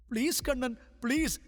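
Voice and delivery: high-pitched tearless begging; pleading. A narrator's reading. Chitra begins begging Kannan to hear her out, pleading. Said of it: "கண்ணனிடம் கெஞ்ச ஆரம்பித்தவள்".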